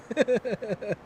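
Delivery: laugh. Real, not acted. Laughs at own joke.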